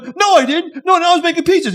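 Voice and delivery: high-pitched mock pleading; panicked protest. Pizza guy denies throwing bomb to accuser, panicked high protest.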